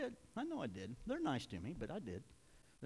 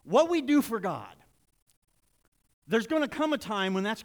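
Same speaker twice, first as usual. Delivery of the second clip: loud, emphatic preaching with stretched key words; 5 semitones higher, 10 dB louder than usual. Real, not acted. Preacher warning that works will be tested, preaching forcefully. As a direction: press the warning home from the pulpit.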